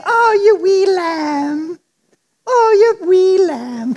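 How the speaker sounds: slow, high Irish granny cooing; Irish granny accent. Retold or imitated. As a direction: coo like an Irish grandmother over a baby. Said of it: "she's Irish and she has that accent".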